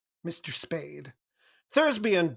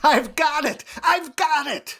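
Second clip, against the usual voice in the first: excited shout; 8 semitones higher, 7 dB louder than usual. Acted. Cairo finds the Falcon.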